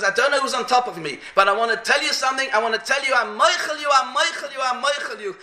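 high, pressing retold plea; urgent forgiveness. Dying man forgiving whoever lies on him, urgent. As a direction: repeat it urgently, climbing in pitch. Dying man's last words, acted.

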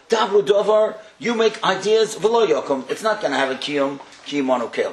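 loud, high preaching of the verses; fervent preaching. Acted. Rabbi preaching the verses to the audience, loud and fervent. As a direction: proclaim each verse like a promise.

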